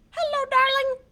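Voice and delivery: high, loud squawky parrot. Acted. Showing kids how a parrot talks, high squawk. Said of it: "Can you speak like a parrot?".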